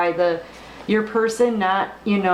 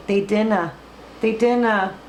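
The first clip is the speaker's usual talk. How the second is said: put-on a light Scottish accent on dinna; a hint of Scottish brogue. Slips into Scots phrase.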